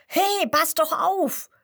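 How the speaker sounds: high, loud, slow squirrel yelp; angry shout. Acted. Squirrel bumped on the trunk, angry high shout. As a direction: snap at the clumsy squirrel. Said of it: "ruft das andere Eichhörnchen wütend".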